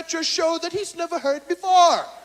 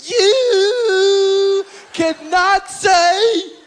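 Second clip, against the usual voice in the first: mock angry Cosby; 3 semitones higher, slower than usual. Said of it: "Bill got pissed and said".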